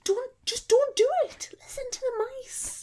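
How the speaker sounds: very high squeaky mouse. Acted. Mock squeaky mouse warning.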